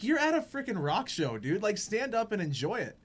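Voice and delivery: high-pitched, incredulous retold comeback; animated mock exasperation; exasperated retort. Retold retort to complaining man.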